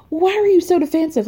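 high-pitched imitation of an accusing question; exasperated challenge, acted out high; mock indignant. Acts out Sutton's heated question.

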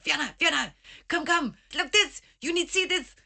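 high, urgent imitated call; excited calling out. Digger calls Fiona over to see his find, excited retold shout. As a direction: mimic an excited man calling someone over. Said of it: "he shouted".

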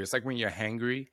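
normal talking voice

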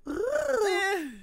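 high, strained raccoon cry; a shrill critter squeal; raccoon noise. Retold or imitated. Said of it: "That, that's not what it sounds like".

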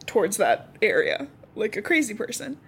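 ordinary talk

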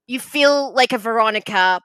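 high, drawn-out quiz verdict; BuzzFeed quiz host. Acted. BuzzFeed interviewer assesses a demon, playful high host voice. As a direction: give a mock reading, stretching the opening. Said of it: "they'd be like".

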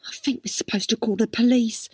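usual speaking voice

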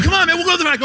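high, loud, insistent party guy; pushy drunk guy. Retold or imitated. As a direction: imitate the birthday guy begging him to party. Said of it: "He's like".

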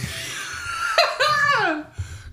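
long burst of laughter; high laughter. Real, not acted. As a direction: crack up laughing. Laughing at the bit.

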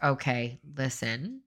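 soft, patient scolding; gentle correction. Retold or imitated. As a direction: let him down kindly, quietly. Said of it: "I might be like".

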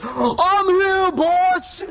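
high put-on hero cry; heroic arrival. GM voicing Christopher arriving to save his friend. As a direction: shout it high like a hero bursting in. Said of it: "He goes".

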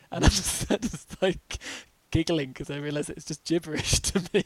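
giggling through the words; real giggle, voice up; laughing while explaining. Real, not acted. Laughing at foreign chatter.

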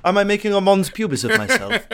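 high, loud, laughing question; laughing through it. Real, not acted. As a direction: crack up at your own pun. Host cracks up at own pun.

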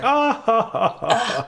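high-pitched imitated group laugh; mock laughter. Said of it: "they're all like".

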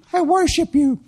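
very high mock-girl chant; girl's repeat. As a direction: parrot a mock prayer in a high girlish voice. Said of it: "And she said".

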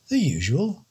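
lightly voiced posh old don; a hint of a plummy English don; posh gent. The don to the detective, a hint of a plummy gent.

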